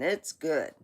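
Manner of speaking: ordinary talk, nothing marked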